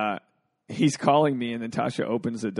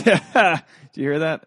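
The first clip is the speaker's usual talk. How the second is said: voice crack; 6 semitones higher, 8 dB louder, faster than usual. Real, not acted. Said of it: "I just cracked my voice".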